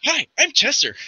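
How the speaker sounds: put-on high, childlike cat-folk greeting; chirpy little catfolk; cheerful Chester. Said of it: "He's like".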